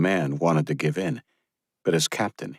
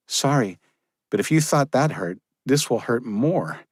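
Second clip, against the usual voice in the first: higher, firm woman's voice; young woman's voice; 6 semitones higher than usual. Acted. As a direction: give a brisk order. Josephine warning dryly.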